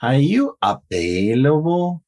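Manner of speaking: slow demo, AVAILABLE climbing at the end; rising yes-no intonation. Shown as a demonstration. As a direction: stretch it out, lifting AVAILABLE upward. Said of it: "it doesn't go up and down".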